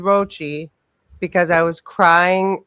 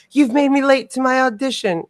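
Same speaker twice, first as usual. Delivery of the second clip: higher-pitched yelling British woman, imitated; mock British accent; 6 semitones higher than usual. Retelling a road-rage yeller at a stoplight, mock British accent. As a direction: put on British indignation, pitched up. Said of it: "yelled at me; didn't have a British accent".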